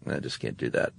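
a guilty cook muttering under his breath; mock quiet conscience-stricken cook; cook's aside. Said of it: "and he's like".

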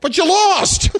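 squeaky high taunt; high mocking retort. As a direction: needle him in a high voice.

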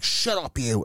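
high mock snap; retold snapping. Woman silencing a meddling bystander, mock snappy. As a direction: mimic her cutting retort, pitched well up.

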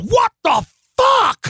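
loud, high, drawn-out roar; outraged shout. Retold or imitated. Punched biker turns on him in the story, outraged shout. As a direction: imitate a furious biker yelling.

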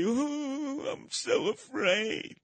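high, slow, hammed-up whimper of fear; mock the scared guy with a high whine. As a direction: pretend cowering whimper, high and drawn out. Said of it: "Instead of whimper away".

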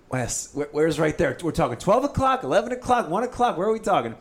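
high, loud, frantic questions; 1 frantic asking. Retold or imitated. Angler begging the guide to say where the fish is, high and frantic. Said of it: "And I'm like".

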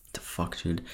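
soft whispered "the fuck, dude"; whispered exasperation. Real, not acted. Stumbling on the name to viewers, a whispered exasperated aside. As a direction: breathe it out, exasperated with himself.

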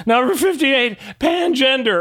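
high-pitched mock roll call; conductor calling out. Conductor reading out the last gender, high announcing voice.

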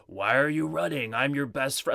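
high-pitched attempt at a demon voice; half-managed horror villain impression; Freddy Krueger. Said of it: "He sounds like Freddy".

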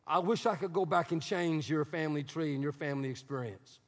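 soft, low, sincere sorrow; sincere apology. Real, not acted. Pastor consoling hurt listeners, softly and low. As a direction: gently tell them you're sorry. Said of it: "I'm sorry".

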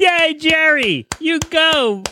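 high, slow, squealing cheer; hammed-up cheerleader yay; mock cheering for Jerry after the song.

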